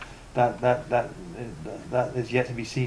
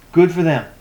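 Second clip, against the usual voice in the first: louder, higher mock congratulation; sarcastic; 4 semitones higher, 8 dB louder than usual. Forum moderator brushing off a customer, sarcastic. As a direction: throw it out with loud sarcasm. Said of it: "very sarcasm, like".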